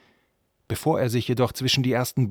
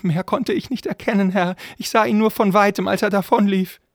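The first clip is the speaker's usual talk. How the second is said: very high, loud, squeaky voice; pleading frightened servant; 11 semitones higher, 8 dB louder than usual. Acted. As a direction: beg your master to believe you. (flehender Diener mit hoher Stimme)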